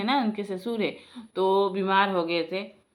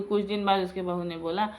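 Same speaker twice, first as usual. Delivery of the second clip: ordinary delivery, nothing marked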